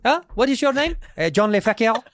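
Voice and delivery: put-on high-pitched comic French accent; mock French accent. Acting out being asked his name, high mock French accent. Acts out meeting the oddly named man.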